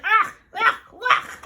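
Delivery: three short, loud, high yelps; loud, high "ach" noises; mud puddle's cries. A narrator's reading. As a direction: yelp in disgust, three quick bursts. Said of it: "The mud puddle said".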